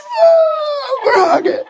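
very high shriek of joy; retold elation, pitched sky-high; overjoyed whoa. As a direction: squeal through the hug. Acts out overjoyed hug with coworker.

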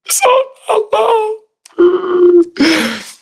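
very high, slow sobbing imitation; imitating bawling. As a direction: draw it out in a mock tearful wail. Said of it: "He's bawling".

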